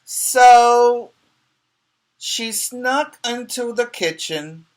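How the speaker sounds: high, slow storybook read; reading to children. Acted. Teacher reading the story to young pupils, storybook voice. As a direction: read it like a sneaky story moment.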